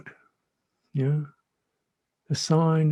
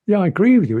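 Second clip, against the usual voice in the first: emphatic agreement; 7 semitones higher, 8 dB louder, much faster than usual. Retold or imitated. Imagined patient reply.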